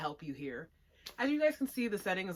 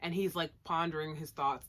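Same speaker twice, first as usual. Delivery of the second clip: ordinary talk, nothing marked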